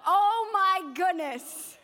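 loud, high, drawn-out rapture; hammed-up foodie ecstasy; swooning delight. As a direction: stretch it out, overwhelmed by temptation. Raving over five dollar dessert.

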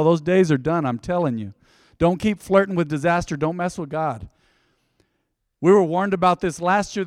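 usual speaking voice

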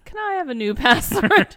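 high, loud plaintive piggy whine; pleading Daddy Pig. Acted. Wife quoting Daddy Pig to husband, high loud whine. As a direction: beg like an embarrassed cartoon dad. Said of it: "And Daddy Pig says".

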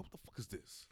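low, whispery baffled aside. Retold or imitated. Imitating Barry on take five, hushed disbelief. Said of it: "He was just like".

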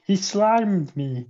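slow, robotic game-voice imitation; old video game speech chip; poor digitized voice. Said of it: "in a very poor digitized voice".

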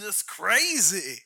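slow, drawn-out actor impression; Randy Quaid. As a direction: stretch it into a loopy drawl. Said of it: "We've got Randy Quaid".

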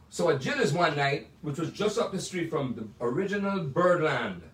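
ordinary talk, nothing marked

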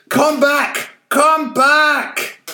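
put-on old man yelling as they fly off; desperate yell. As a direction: scream after the departing tub. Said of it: "yelled the old man".

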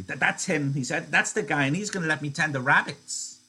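Lenny eagerly talking about George; happy Lenny. Acted. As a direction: beam about the rabbits.

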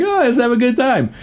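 high fake-cheery voice; mock the forced positivity; mock cheerful. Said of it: "I can't just be like".

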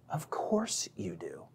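low, slow whisper; whispered aside. Real, not acted. Preacher answering his own question to listeners, whispered aside. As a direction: drop to a hushed, knowing whisper.

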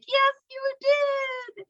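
hushed high-pitched swooning; gushing fan reply. Retold or imitated. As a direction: coo it back at the commentator, high and slow. Acts out own gleeful reply.

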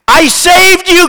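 high, loud recited outcry; crying out. A narrator's reading. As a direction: cry it out as the betrayed woman in the poem. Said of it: "cried the woman".

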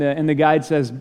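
usual speaking voice

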